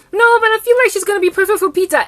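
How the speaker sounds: high, loud gushing mother; mother compliments the girl, high and loud; cooing mom. Retold or imitated. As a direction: translate her gush, still high and loud. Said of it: "mother insists".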